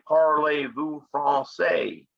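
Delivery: slow Southern US drawl on the French words; French said in a Southern US accent, slowly. Retold or imitated. Teacher imitating Louisiana French, slow Southern US accent. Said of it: "they say".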